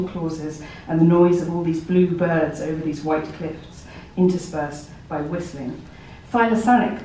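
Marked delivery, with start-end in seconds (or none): 6.3-7.0 voiced companion. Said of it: "they might say".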